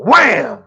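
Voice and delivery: loud, high "wham" bursting out; loud slam imitation. Storyteller acting a teacher slamming papers, loud slam imitation. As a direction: punch it like paper slapped on a table.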